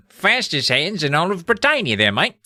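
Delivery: broad, hammed-up British accent. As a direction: lean into a matey British accent. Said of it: "Everyone's got British accents".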